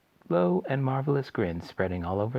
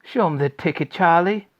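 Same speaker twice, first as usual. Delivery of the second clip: loud, high call; shout; 8 semitones higher, 8 dB louder than usual. Acted. Grandpa Joe urging Charlie, loud high call. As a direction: call over the din. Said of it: "shouted Grandpa Joe".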